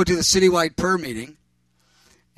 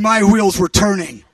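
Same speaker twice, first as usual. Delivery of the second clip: normal talking voice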